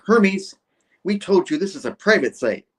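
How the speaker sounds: mock annoyed. Doctor telling Hermes off, acted annoyed scolding. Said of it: "the doctor's all annoyed".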